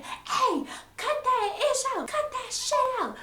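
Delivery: put-on high shrill-free squeal of an old lady breaking it up; grandmother yelling. Grandmother ordering the girls to stop, high yell.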